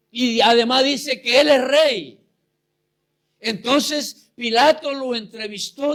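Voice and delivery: loud, driving sermon voice, pushing each line; fiery preaching. Acted. Preacher retelling the trial of Jesus, in fiery preaching. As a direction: preach it out to the congregation.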